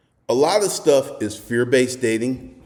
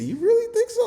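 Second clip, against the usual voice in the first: high, much higher imitating the tall woman; woman's shy reply; 4 dB quieter than usual. Retelling the tall blonde surprised at the compliment, high imitated voice. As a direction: play her flattered, doubtful answer.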